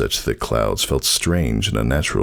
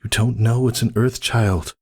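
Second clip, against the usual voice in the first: higher, pleading friend voice; worried Cirrus; 8 semitones higher than usual. Acted. Cirrus begs Whisper to reconsider, higher and worried. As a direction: plead with her to wait.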